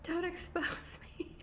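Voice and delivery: high, slow, laughing through the words; laughing protest. Real, not acted. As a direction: plead while cracking up. Laughing at being teased.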